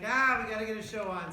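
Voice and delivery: a jolt of loud, high-pitched mock panic; mock panicked realization. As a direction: blurt it out in comic alarm. Teacher acts out sudden morning panic.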